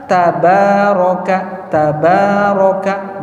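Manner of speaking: "tabaroka" slowly, every a open and clear. Shown as a demonstration. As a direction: stretch "tabaroka", mouth open on each a. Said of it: "bacaan yang benar ... terbuka". ("tabaroka" pelan dan terbuka)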